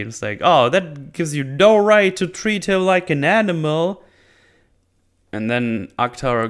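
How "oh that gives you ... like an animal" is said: lightly acted indignant rebuke, higher and louder; mock scolding. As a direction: scold him as the good lady, raised. [name] scolding, voiced.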